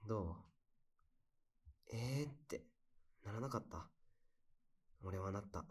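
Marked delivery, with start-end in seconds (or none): 1.9-2.3 surprised. Pretend. Shared surprised reaction.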